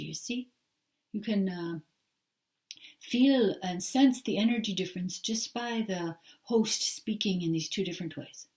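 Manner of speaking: ordinary talk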